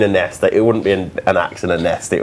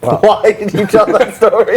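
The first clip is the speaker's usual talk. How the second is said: laughing; 14 semitones higher, 4 dB louder, slower than usual. Real, not acted. Laughing at a silly story.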